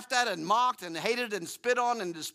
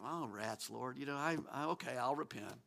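low and much softer, a sheepish sigh of giving in; grudging repentance; 6 semitones lower, 10 dB quieter than usual. Retold or imitated. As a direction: mutter a reluctant okay to God. His own grudging reply to God.

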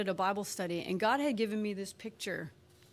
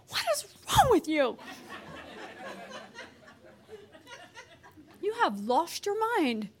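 exasperated outburst; 11 semitones higher than usual. Retold or imitated. Wife snapping at her too-happy husband, exasperated. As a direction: tell him off in mock disbelief.

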